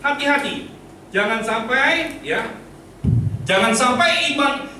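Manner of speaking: high, urgent pulpit warning; emphatic sermon caution; forceful preaching. Real, not acted.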